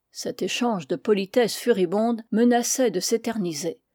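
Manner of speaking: usual speaking voice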